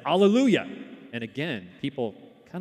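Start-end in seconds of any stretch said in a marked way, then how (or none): 0.0-0.7 high, loud joyful Alleluia. Retold or imitated. Visitor exclaiming after a prayer, high and loud. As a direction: cry out Alleluia in praise. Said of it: "this same woman said".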